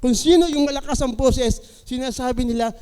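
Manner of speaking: ordinary talk, nothing marked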